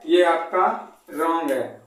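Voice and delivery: usual speaking voice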